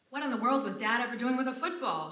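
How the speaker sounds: mock the story with a coarse showgirl voice; uncouth nightclub singer. Lola mocking Jerry's father story in a brash, uncouth voice. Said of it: "[name] plays Lola as an uncouth".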